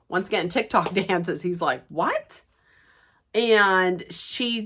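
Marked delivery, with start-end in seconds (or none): 1.9-2.4 high, rising mock-baffled question; incredulous. Recapper voicing his reaction to being told off, incredulous. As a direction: imitate a taken-aback man asking in disbelief.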